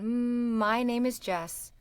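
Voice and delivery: hummed M, then slow lilting "my name is Jess"; sing-song speech. Shown as a demonstration. Coach models student's name phrase, sing-song "my name is Jess". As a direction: stretch the M into "my", falling on "Jess". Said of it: "kinda sing-song speak the rest".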